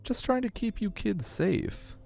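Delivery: high, soft, slow guide voice; nervous aquarium guide. Acted. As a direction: excuse yourself softly in a high voice. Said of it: "The guide looks nervous".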